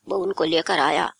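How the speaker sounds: plain reading voice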